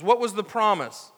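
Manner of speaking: ordinary delivery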